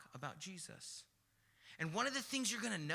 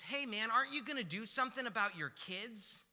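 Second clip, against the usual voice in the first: raised, higher voice of exasperation; irate passenger; 6 semitones higher, 6 dB louder than usual. Retold or imitated. Passenger scolding a father about his kids, irate. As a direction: confront him, louder and higher. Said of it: "he's getting irate".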